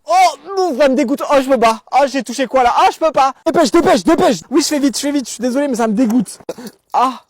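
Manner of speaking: high, loud cries of disgust; disgusted squealing. Acted. Fouillant son sac sale, crie de dégoût au caissier. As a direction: squeal in revulsion.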